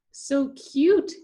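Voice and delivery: high, loud gushing cry; excited exclaim. A narrator's reading. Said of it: "Tuli exclaimed".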